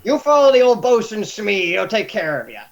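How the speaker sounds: high gruff captain fading off; pirate captain. Acted. Pirate captain sending the new man off with the bosun. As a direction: hand him over to the bosun gruffly.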